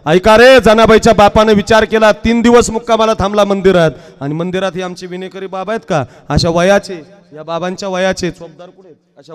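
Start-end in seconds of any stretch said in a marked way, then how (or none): 0.1-3.9 higher, louder storyteller's summons; loud call for attention. Real, not acted. Preacher to congregation opening Janabai's story, loud calling out.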